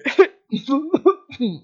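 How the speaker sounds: loud, showy coughing; fake little coughs. A narrator's reading. Wolf drowning out his rumbling stomach, fake coughing. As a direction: cough to cover something up. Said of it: "mit Hüsteln zu übertönen".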